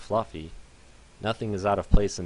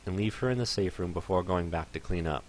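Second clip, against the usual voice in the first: ordinary delivery, nothing marked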